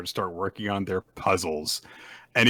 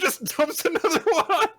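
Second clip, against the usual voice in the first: high, laughing mock protest; laughing outrage. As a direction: crack up while protesting, slow on bullshit. Outraged at the third puzzle.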